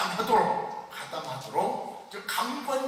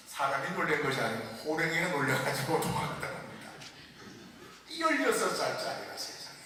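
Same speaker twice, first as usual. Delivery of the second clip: ordinary delivery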